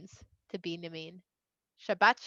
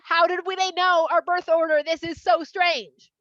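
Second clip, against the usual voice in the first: raised, louder voice of baffled brothers; freaking out; 4 semitones higher, 6 dB louder than usual. Retold or imitated. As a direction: act out mock panicked disbelief. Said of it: "they're, like, freaking out".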